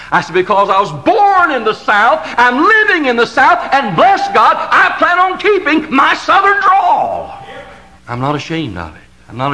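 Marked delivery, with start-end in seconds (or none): 0.3-7.4 much higher and louder, slowing at the end; proud pulpit shout of defiance; defiant preaching. Acted. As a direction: throw it back with pride. Preacher's defiant retort climaxes.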